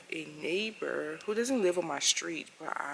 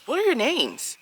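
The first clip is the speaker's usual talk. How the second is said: loud, higher, pushy "What are your names?"; imitating a hostile neighbor, loud and sharp; aggressive demand; 10 semitones higher, 8 dB louder than usual. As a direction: bark it at her like an accusation. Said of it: "That's what I mean when I said aggressive".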